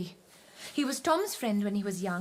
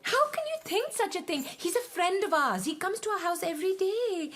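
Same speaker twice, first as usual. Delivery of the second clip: alarmed denial; 9 semitones higher than usual. Acted. Shocked denial of borrowing.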